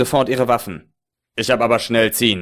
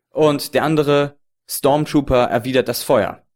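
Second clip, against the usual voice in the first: normal talking voice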